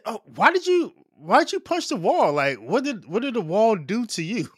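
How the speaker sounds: high, incredulous mock question; a lightly voiced, high puzzled bystander; mock bewilderment. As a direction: play it as baffled disbelief at the wall-puncher. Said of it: "and he said".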